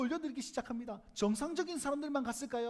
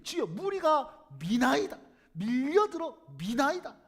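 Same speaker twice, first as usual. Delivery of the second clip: louder, slow, seething 무리가 and 미나이다; irritated; 7 dB louder, slower than usual. Shown as a demonstration. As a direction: fume it out, words dragged. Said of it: "속에서 불이 올라오듯이 그렇게 얘기했을".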